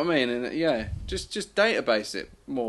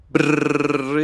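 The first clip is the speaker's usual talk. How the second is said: lips trilled brrr; mock shivering brrr; 2 semitones higher, 8 dB louder than usual. Host opens competition segment.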